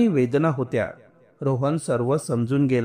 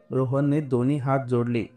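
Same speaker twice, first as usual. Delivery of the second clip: usual speaking voice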